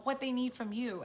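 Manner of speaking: usual speaking voice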